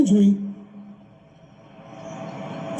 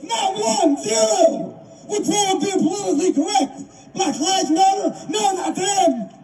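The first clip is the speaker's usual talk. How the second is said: angry; 7 semitones higher than usual. Real, not acted. Rising outrage, shouts measured.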